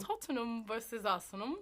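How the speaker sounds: careful copy of Cockney; attempted Cockney accent. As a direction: tentatively echo the Cockney accent. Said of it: "say it in a bit of a Cockney accent".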